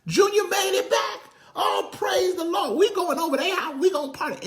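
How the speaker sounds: very high, loud, jubilant shout; excited townsfolk shout. Acted. Neighbors cheering the prodigal son's return, high shouting. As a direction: scream it like the whole city just heard. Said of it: "the whole city was like".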